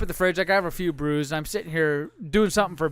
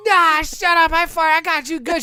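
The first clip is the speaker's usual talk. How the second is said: high, lightly acted brush-off; gloating dad; 9 semitones higher, 5 dB louder than usual. Dad brushes off daughter after his prank, mock gloating. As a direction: re-enact a cocky, dismissive comeback.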